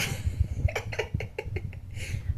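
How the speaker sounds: voiceless chuckling run; breathy laughter. Acted. Spirit greeting someone she knows, breathy laughing.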